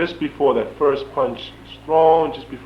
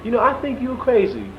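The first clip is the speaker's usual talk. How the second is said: high-pitched, louder sheriff take-off; imitating Sheriff Tyson; 13 semitones higher, 5 dB louder than usual. Sheriff to prisoner leaving the hole, high-pitched imitation. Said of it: "he said".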